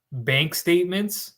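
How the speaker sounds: ordinary talk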